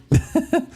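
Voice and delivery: short high giggle; high laugh. Real, not acted. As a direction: laugh at your own joke. Laughing after joke.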